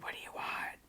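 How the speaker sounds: unvoiced mock whisper. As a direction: hiss it under your breath. Retold reply to strangers.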